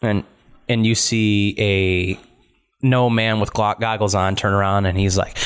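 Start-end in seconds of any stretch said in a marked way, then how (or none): none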